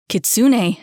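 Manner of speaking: very loud shout of his name; calling out. Acted. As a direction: shout to stop him. Bellator calls after running boy.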